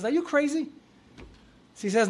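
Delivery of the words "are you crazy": high, incredulous acted reply; shocked refusal. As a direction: play the friend appalled at the offer. Friend refuses cheeseburger offer.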